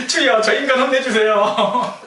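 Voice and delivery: high, loud, slow wail to God; hammed-up shouted plea with laughter; mock fervent prayer. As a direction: cry out to heaven in jest. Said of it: "이렇게 기도하겠죠".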